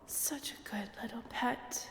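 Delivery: softly whispered praise; teasing whisper. Acted. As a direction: whisper it like to a pet. Vampire purrs at disarmed hunter.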